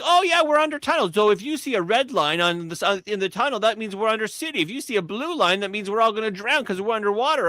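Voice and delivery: Boston navigator voice. Retold or imitated. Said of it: "and then she's like".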